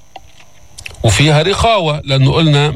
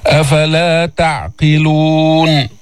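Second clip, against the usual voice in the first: very slow chanted recitation; Quran recitation; much slower than usual. Shown as a demonstration. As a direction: stretch every long vowel. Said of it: "teacher recites verses".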